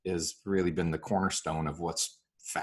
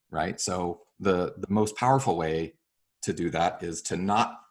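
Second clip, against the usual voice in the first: usual speaking voice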